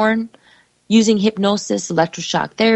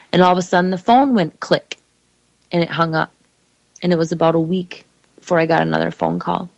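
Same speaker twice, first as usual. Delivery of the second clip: normal talking voice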